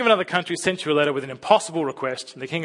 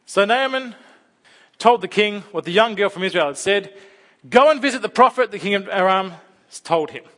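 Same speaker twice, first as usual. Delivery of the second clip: steady, louder Bible reading to the congregation; scripture reading; 4 semitones higher, 5 dB louder than usual. Acted. Preacher reading the Naaman story aloud to the church, a clear, louder read. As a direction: read the passage out with weight.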